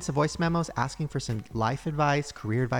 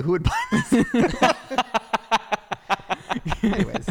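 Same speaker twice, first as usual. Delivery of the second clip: very high, laughing squeal; cracking up mid-sentence; 6 semitones higher than usual. Real, not acted. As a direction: break into high-pitched laughter on the question. Laughing at own merch idea.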